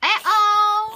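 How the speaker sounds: a bright, loud greeting shout; loud call. Real, not acted. Mother calling out to her baby, loud and bright.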